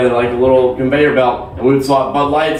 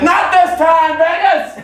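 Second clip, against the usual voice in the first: high-pitched victory yell; triumphant shout; slower than usual. Acted. Man shouts at the Vegas strip from his window, triumphant.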